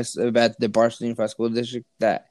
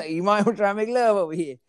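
much higher, joking put-on voice; laughing mockery; 10 semitones higher than usual. Friend mocking a creepy teacher for laughs, high and giggly. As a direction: crack up while mocking the creep.

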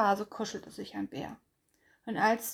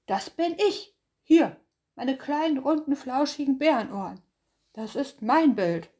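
loud, higher bear claiming it; possessive bear voice; 5 semitones higher, 6 dB louder than usual. Acted. Bär claims the shiny thing as his, loud. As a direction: claim it proudly, a bit raised.

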